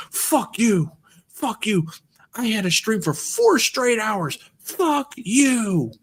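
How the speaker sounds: high-pitched mock outrage; imitated angry rant. Guy back from the kitchen cursing the prankster, mock-angry. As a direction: imitate a furious streamer cursing out a troll. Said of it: "that guy got angry".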